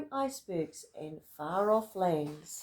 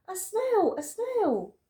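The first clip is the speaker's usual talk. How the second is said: much higher, loud panicked cry; alarmed shriek; 10 semitones higher, 6 dB louder, slower than usual. Acted. Teacher shrieking at a snail on the board. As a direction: shriek at the sight of it. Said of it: "The teacher turned pale".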